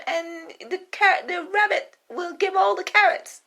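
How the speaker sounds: jittery cook in a squeaky high voice; nervous cook. Acted. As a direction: stammer excuses to your queen. Cook reports to queen.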